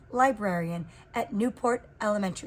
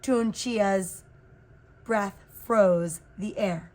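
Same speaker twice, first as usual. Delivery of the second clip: usual speaking voice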